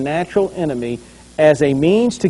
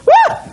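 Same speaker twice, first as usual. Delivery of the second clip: very high, loud startled yelp; yelp of fright; 6 dB louder than usual. Retold or imitated. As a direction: squeal like a man who sees a knife. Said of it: "and the guy goes".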